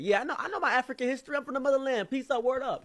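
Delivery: very high, loud hammed-up boast; mock boastful guy. Mocking the man who brags about the motherland, very high and loud. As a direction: ham up the bragging.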